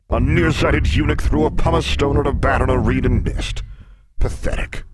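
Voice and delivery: loud, high-pitched bellow of contempt; big beast mocking; scornful sphinx. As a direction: rattle off the answer with booming disdain.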